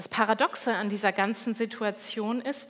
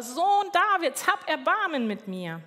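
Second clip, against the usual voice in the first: louder retold call; 6 semitones higher, 5 dB louder than usual. Blind beggar insists over the people hushing him, louder imitated call. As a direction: imitate the beggar calling out harder. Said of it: "er ruft nur lauter".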